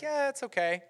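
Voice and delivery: high-pitched noncommittal shrug; lukewarm shrug. Acted. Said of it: "Some of us are like".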